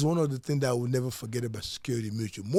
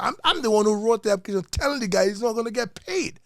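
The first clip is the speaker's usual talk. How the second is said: higher, louder, pressing the point; insistent retelling; 8 semitones higher, 5 dB louder than usual. Retold or imitated. Agent recounting his warning to the customer, insistent. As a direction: insist on it, voice climbing.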